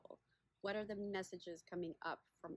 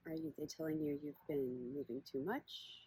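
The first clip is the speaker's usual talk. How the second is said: unhurried meditation guide, long pauses between phrases; guided body scan; 2 semitones lower, 4 dB louder than usual. Acted. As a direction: guide listeners gently inward, leaving space after each question.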